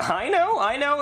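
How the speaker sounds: smug delight. Acted. Puppeteer praised for his character voice, smug.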